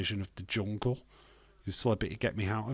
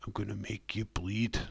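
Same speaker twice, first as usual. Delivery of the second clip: menacing whisper. Pretend. Voicing a wrestler's threat.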